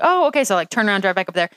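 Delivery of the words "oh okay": high, surprised okay; sudden realization. Retold or imitated. Wife spotting the boyfriend in the dark, surprised. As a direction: pitch up in realization. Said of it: "I was like".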